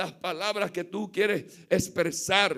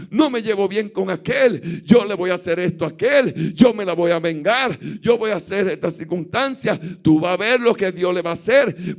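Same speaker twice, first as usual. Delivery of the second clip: forceful preaching; 2 semitones lower, 3 dB louder than usual. Acted.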